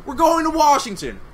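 high, loud victory shout; triumphant yell. Real, not acted. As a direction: shout it in celebration. Celebrating the win.

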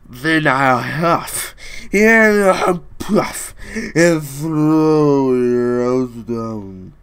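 loud, slow, drawn-out big bad wolf threat; loud, slow menacing wolf.

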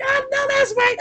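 loud, very high-pitched cheer of agreement; high, loud amen-style backing; emphatic agreement. Retold or imitated. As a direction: whoop it out in agreement. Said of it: "And I'm like".

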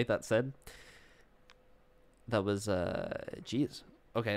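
normal talking voice

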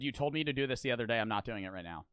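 ordinary delivery

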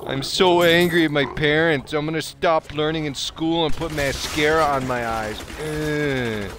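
mock an angsty teen griping about parents; whiny goth teenager. Player mocking Malthael as a sulky teen, whiny goth teenager. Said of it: "You fucking dumb goth teenager".

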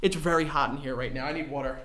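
soft, low, worn-out murmur; drained aside. Real, not acted. Worked-up friend needing water, drained quiet aside. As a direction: drop soft and low after the outburst. Winded after his outburst.

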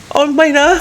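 real fright spilling into a squeal; panicked shriek. Real, not acted. Streamer finding a body on screen, panicked shrieking.

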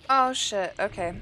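loud, startled curse; real startled cursing; startled swearing. Real, not acted. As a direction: blurt it as it jumps out. Caught by monster.

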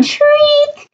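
high imitated librarian shriek; mock shriek. Librarian sees a shark in the library, high mock shriek. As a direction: squeal the word up high. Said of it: "Look at that librarian's going".

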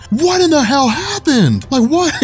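high, slow, stunned outburst; baffled. Retold or imitated. Barry arriving to find the villain dead, high baffled cry. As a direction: pitch it up in total disbelief. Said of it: "he's like".

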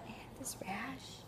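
hushed embarrassed whisper. Real, not acted. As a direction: whisper it so nobody hears. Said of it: "That sounds really bad".

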